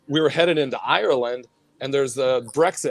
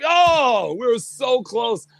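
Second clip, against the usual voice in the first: a high, sudden "Oh", then slower disappointment; dismayed surprise; 10 semitones higher, much slower than usual. Retold or imitated. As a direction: act out the sinking moment of getting caught. Retold dismay at customs stop.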